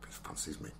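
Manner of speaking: a secret whispered aside. Real, not acted. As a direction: hush it like gossip. Confiding aside to co-host.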